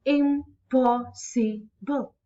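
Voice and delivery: "impossible" broken into four slow, separate syllables. Shown as a demonstration. Teacher counting syllables for students, slow and split up. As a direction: say "impossible" slowly, one syllable at a time. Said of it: "just like I am pronouncing impossible".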